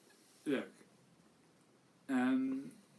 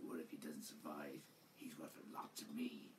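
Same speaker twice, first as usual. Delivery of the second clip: low, soft, mumbled mock of the redubbed line; breathy, almost whispered low parody of a lazy line read; mock mumbled Boba; 9 semitones lower, 15 dB quieter than usual. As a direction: mutter it low and half-hearted, mocking a phoned-in delivery. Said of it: "He's like".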